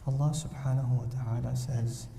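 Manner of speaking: low, soft, measured lecturer voice; slow academic scholar. Retold or imitated. Imitating the dry hadith scholar to the audience, low and soft. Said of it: "He speaks like this".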